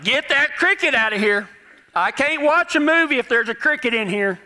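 mock high-pitched wife giving orders; demanding wife. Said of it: "And my wife says".

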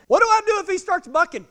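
high, loud mock panic; anxious question. Rider to trainer before the cactus, alarmed question. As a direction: blurt it out in alarm.

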